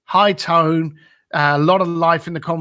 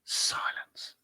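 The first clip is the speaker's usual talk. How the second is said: whispered; 15 dB quieter than usual. Real, not acted. Tense pause before the answer.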